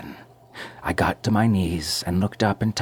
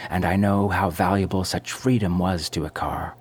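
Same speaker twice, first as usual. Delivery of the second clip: usual speaking voice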